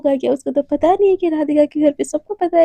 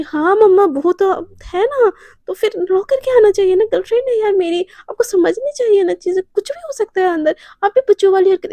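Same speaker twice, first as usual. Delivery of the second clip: put-on higher, whiny teenage protest; indignant arguing; 6 semitones higher, 3 dB louder than usual. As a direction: argue back like a sulky daughter. Daughter argues back at mother.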